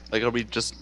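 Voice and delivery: usual speaking voice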